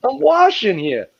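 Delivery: high, hammed-up New York indignation; New York accent. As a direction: play up an outraged New Yorker's accent. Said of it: "you got that New York accent, like, thick".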